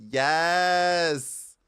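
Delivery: loud, high yes; excited yes. Real, not acted. Vlogger remembering the iPod Touch, cheering excitedly.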